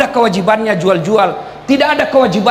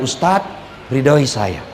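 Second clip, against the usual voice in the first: soft, lower pleading; tender plea; 7 semitones lower, 3 dB quieter, much slower than usual. Retold or imitated. Wife asking her husband for his blessing after a quarrel, soft and tender. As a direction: murmur it gently, asking for a blessing.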